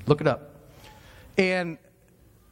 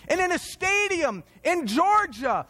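ordinary talk

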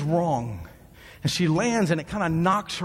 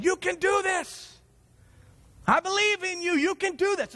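coach yelling; 11 semitones higher than usual. Retold or imitated. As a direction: yell it up high like a coach from the sideline. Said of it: "he's yelling".

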